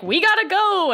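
put-on high, hurried kid cry; urgent kid voice. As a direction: play the panicky kids rushing off. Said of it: "The kids are like".

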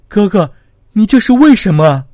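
loud, high-pitched cry; distant shout. A narrator's reading. Sister shouting to her condemned brother at the execution ground, anguished shout. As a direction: call out loudly from far off, voice high. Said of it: "春晓远远大叫道".

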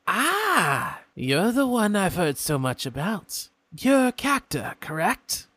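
a polite, curious imp stranger; imp folk voice. Acted. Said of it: "and he says".

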